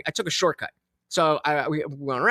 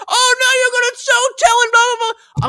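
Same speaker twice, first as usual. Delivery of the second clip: very high, loud mock holler; imitated distant yell; 6 dB louder than usual. Stranger yelling from all the way down, mocking holler. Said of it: "from all the way down here yell".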